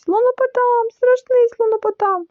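high, wailing alarm cry; panicked yelling. A narrator's reading. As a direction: squeal the alarm in terror. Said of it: "зарепетував паць". (панічний вереск)

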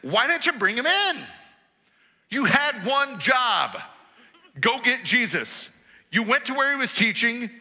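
a touch of mock outrage; demanding priests. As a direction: scold the guards for coming back empty.

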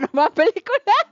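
giggling through no me voy a pelicular; laughing repeat. Real, not acted. Laughing at herself while telling the group, laughing repeat.

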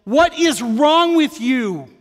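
loud, high, slow retold snarl. Dad snarling at his son at the trailer, loud slow snarl. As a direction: snap at the kid in frustration. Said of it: "I snarled at him".

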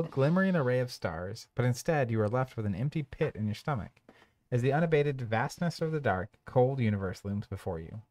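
measured storybook narration; narrator voice. Acted. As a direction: read it like a dark tale. Reads game scenario text.

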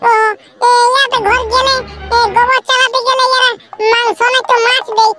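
put-on high, young kid's voice; child's voice. Little kid asking Bhola where they're going, in a high child's voice. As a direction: play a little kid calling after the big boys.

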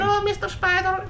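put-on high-pitched Eastern European kid accent; little Eastern European child. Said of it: "giving him an Indian accent".

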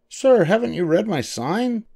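high-pitched shouted question from a little mouse; mock shout. A narrator's reading. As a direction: challenge him in a high character voice. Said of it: "Doctor DeSoto shouted".

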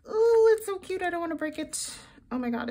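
ordinary delivery

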